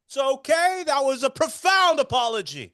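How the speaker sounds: high, hammed-up accepting of an apology; Larry David. Larry accepting Marty's profound apology, high mock impression. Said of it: "And Larry goes".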